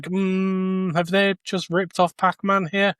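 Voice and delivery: ordinary talk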